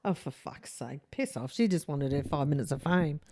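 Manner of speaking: low, soft grumble; muttered exasperation. Real, not acted. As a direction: grumble it under your breath, dismissively. Grumbles at the false witness.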